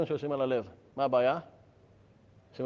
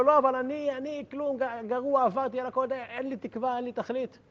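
much higher pleading voice of a broken man; 8 semitones higher than usual. Retold or imitated. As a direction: plead like someone who has given up hope. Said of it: "אמר לו".

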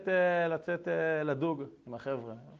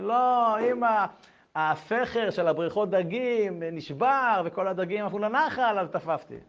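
higher, slow, nervous-sounding excuse; flustered excuse; 6 semitones higher, 5 dB louder, slower than usual. Retold or imitated. Son making excuses to his mother, higher and slow. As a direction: stall with a made-up story.

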